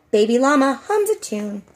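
usual speaking voice

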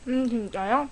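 chewing while talking; sipping soup from spoon; mouth full. Real, not acted. Seen 2: chews between words, then spoon at lips.

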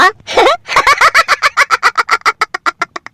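long, very high villainous laughter; gleeful villain's cackle at the murder plot; evil laugh. Acted. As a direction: cackle over the wicked plan.

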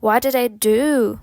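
connected, natural-speed question. Shown as a demonstration. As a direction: run it together in one go. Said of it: "потім швидше".

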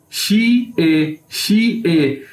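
"शी ए" slowly as two separate sounds; syllable-by-syllable demo. Shown as a demonstration. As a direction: say "शी ए" as shee, then ay. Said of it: "ई लग गया तो ए".

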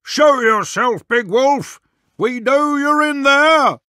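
high, slow challenge called out; crying out. Acted. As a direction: woodcutter calling out the hidden wolf. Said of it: "The woodcutter cried".

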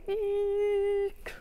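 high squeaky eek; playful worried squeal; mock eek. Shown as a demonstration. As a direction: squeak like a nervous kid. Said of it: "what does your worried face look like".

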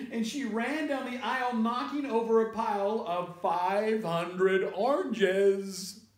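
high, excited storytelling; frantic narration. A narrator's reading. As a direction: keep the panic going as you narrate. Narrating the panicked flight.

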